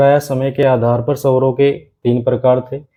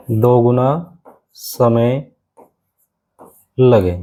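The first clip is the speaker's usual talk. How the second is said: very slow, drawn out for writing down; teacher dictating a note very slowly; very slow dictation; 2 semitones lower, much slower than usual. Shown as a demonstration. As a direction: stretch each word so they can copy it. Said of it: "तो आप लिख सकते हो".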